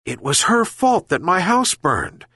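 put-on elderly nasal whine; complaining old man. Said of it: "complained the old man".

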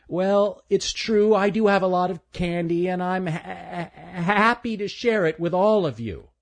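eager but stammering; stuttering young boy. Acted. Stanley voiced.

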